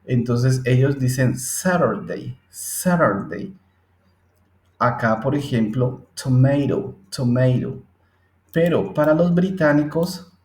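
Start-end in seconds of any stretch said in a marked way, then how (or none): 1.4-3.5 American Saturday with the t flapped to r, saɾaɾdei, slow; American accent. Shown as a demonstration. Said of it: "para los americanos ... suena con R". 6.1-7.8 American tomato with the t as r, tomeiɾo; American flap in tomato; American accent. Shown as a demonstration. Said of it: "para los americanos ... suena con R".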